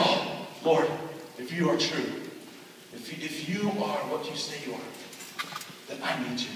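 soft, breathy plea to God; hushed prayer. Retold or imitated. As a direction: pray quietly, half whispering. Boy's first quiet prayer.

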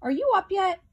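high-pitched calling question; Sally Skunk. A narrator's reading. Sally Skunk checks if Gregory is up, high calling voice. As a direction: lift it right up, calling to someone asleep. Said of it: "called Sally Skunk".

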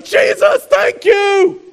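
high shouted praise; loud, high call of praise; joyful cry. Pretend. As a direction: cry out thanks to Jesus. Worshipper in heaven calling out.

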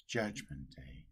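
normal talking voice

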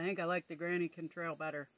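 chewing tomato between words; talking while eating. Real, not acted. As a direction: mouth partly full. Seen 2: holds tomato at mouth, biting and chewing while speaking.

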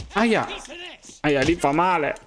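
high playful ouch; mock pain. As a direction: yelp as if you got hit. His character gets hit. (lamento scherzoso di dolore, acuto)

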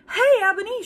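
called out high and loud; a high, loud hail across the grounds; shouted call. A narrator's reading. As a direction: yell her name across the yard. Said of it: "shouted a voice".

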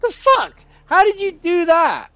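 can't believe it just vanished; shocked outburst. Real, not acted. Player yelling at the teleporting cow, shocked.

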